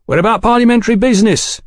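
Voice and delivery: high, fast protest; indignant tough. A narrator's reading. Swarthy man justifying himself to Fraser, indignant. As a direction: assert your business, pitched well up.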